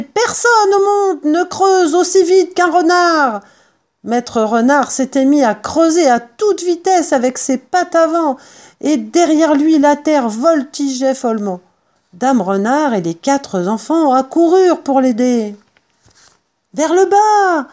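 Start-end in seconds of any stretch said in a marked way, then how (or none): 0.0-3.4 high, loud triumphant yell. Acted. Mr Fox starts digging with his family, yelling. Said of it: "hurla Maître Renard". 16.7-17.7 loud, high shouted command; ordering. Acted. Mr Fox directs his digging family, shouting orders. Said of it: "ordonna Maître Renard".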